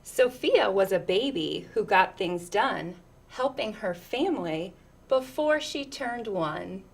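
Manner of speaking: lilting, rhythmic read-aloud; picture book reading. Acted. Reading a picture book to children, lilting storyteller voice.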